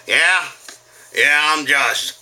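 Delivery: lightly put-on loud dad; a hint of goofy dad announcing himself; embarrassing dad. Showing up at kid's school, lightly hammed-up dad.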